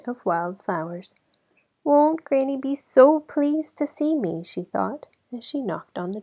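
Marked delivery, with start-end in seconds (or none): 1.8-4.4 put-on little girl voice. A narrator's reading.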